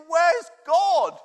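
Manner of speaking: put-on high, loud, slow, whiny boy close to tears; upset child. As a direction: plead like a frozen, frustrated kid. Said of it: "it's just like, basically".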